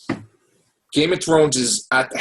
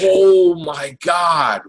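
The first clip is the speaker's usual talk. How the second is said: very slow, stretched oh my God; a long, louder gasp of awe; drawn-out amazement; 3 semitones higher, 4 dB louder, much slower than usual. Real, not acted. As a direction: savor the words in disbelief. Raving about Penny Dreadful.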